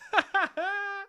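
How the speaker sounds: soft, high giggle; real amused chuckle; laugh. Real, not acted. Laughs at own impression.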